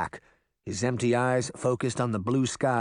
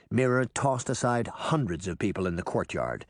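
plain reading voice

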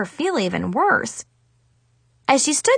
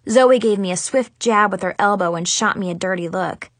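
ordinary delivery, nothing marked

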